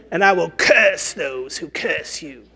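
slow, put-on witch voice; witch's curse. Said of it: "or the cursing of the witch".

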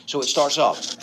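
ordinary delivery

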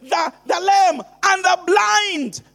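fiery preaching. Acted. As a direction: thunder the master's orders at the crowd. Preacher at full force, quoting master.

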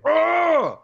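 high, loud imitated agony yell; hammed-up howl of pain; mock pained scream. Stressing how seriously he screamed, loud mock scream. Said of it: "he screams in agonizing pain".

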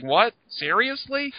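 high-pitched disbelieving What, Seriously; incredulous. Retold or imitated. Friend retelling the Joker scene, incredulous. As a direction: squeak out the disbelief.

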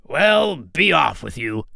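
lightly voiced dismissive troll. A narrator's reading. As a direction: send them off as a storybook troll. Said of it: "said the troll".

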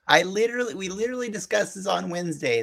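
high, exasperated disbelief; mock-indignant rant; exasperated outburst. Mock outrage at co-host.

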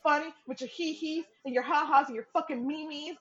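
high mock tough-guy voice; mock taunting brother. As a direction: ham up his threat. Brother's rant imitated.

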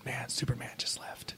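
hushed, voiceless aside; awed whisper. Retold or imitated. Fan confides in director as the star leaves, whispering. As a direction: whisper it like a secret fan thought.

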